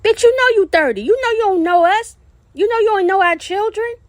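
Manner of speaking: shouting accusations, voice pitched high in anger; furious shout. Real, not acted. Furious rant at a woman.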